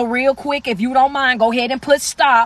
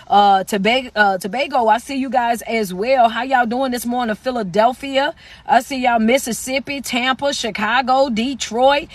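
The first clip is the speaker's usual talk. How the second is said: normal talking voice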